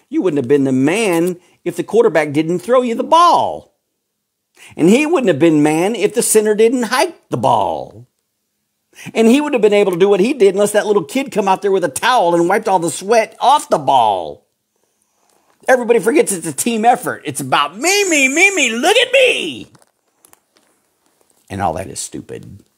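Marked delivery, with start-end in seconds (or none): 0.1-17.2 rising higher and louder, then fast; building rant. Real, not acted. Preacher rails at show-off players, building to a loud fast rant. 17.3-19.7 high, squeaky mocking me, me, me; mock show-off. Preacher mimics a touchdown scorer's bragging in a high mocking voice.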